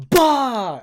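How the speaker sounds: loud, high smack sound; explosive mock impact; punch impact noise. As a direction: shout the blow as it lands. Imitated blow to the face.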